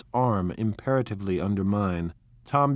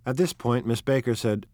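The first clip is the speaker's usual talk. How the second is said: ordinary delivery, nothing marked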